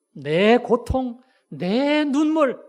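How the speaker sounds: slow, loud, high-pitched lament; acted-out anguish, heavily stretched; anguished outcry. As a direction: draw out each hurt and cry it out. Preacher voices Jephthah's pain and tears.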